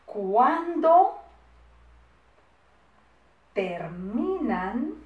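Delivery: very slow, syllables held long; drawn-out syllable-by-syllable dictation. Shown as a demonstration. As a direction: stretch each word for pupils copying. Teacher dictating word by word.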